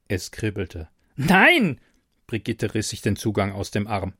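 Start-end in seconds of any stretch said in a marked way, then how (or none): 1.2-1.8 high, loud desperate cry; panicked no. Acted. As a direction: shout it out in horror. Brigitte panics.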